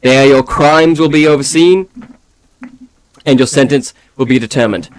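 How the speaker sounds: stern official reading charges; formal proclamation. Acted. Guard reads the charges.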